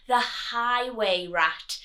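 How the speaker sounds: plain reading voice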